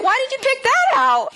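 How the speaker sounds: very high-pitched mock complaint; hammed-up incredulous question; indignant bafflement. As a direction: act out baffled disapproval, way up high. Re-enacts second confused complaint.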